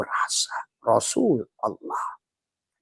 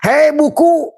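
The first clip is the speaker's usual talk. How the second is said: calling out; 7 dB louder, slower than usual. Acted. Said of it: "addressing book in character".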